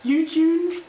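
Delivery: high, loud, startled at her own slip; amused surprise. Real, not acted. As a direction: catch your own mistake with a yelp. Laughing at own word mix-up.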